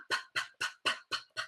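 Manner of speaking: "P" as a soft, unvoiced lip pop; breathy bubble-popping "P" for kids; popping P sounds. Shown as a demonstration. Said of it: "So we're gonna go P".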